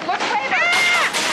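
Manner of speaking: high, loud alarmed cry; panicked shout. Acted. Mother shouting as the kids wreck things. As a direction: yell in sudden alarm.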